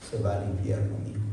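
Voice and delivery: David realizing his son is lost, low; low dread. Acted. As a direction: drop the voice to a dark murmur. Voicing David's inner thought.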